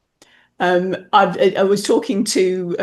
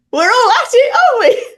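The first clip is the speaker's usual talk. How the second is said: South East London with Belfast lilt, high and loud; hammed-up London accent with soaring Belfast intonation; sing-song London-Belfast accent; 7 dB louder, faster than usual. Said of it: "picked up her sing-songy intonation".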